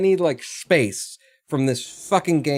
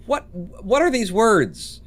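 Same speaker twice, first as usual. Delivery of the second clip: higher, bewildered question; baffled; 7 semitones higher, slower than usual. Real, not acted. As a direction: puzzle over nonsense words. Reads a baffling suggestion from chat.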